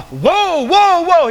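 loud, high-pitched whoas; calling a halt. Acted. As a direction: pull someone up short, calling out. Acted God halting Isaiah abruptly.

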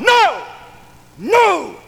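high, loud shouted no; acted father's booming refusal, pitched high; emphatic refusal. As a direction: cut them off with a shout. Father cuts off son's plea.

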